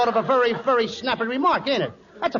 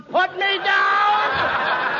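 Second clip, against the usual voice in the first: shouting put me down; 4 semitones higher, much slower than usual. Acted. Being carried, shouts to be dropped.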